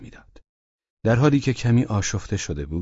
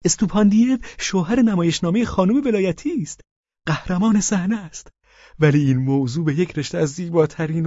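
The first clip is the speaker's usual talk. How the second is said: higher, eager, fawning husband voice; Pavel Pavlovich voiced; 11 semitones higher than usual. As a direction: play the meek widower, pitched up. (صدای پاول پاولویچ، زیر و چاپلوس)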